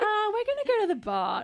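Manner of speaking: high whiny mock voice; mocking friends. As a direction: mimic the friends dismissively.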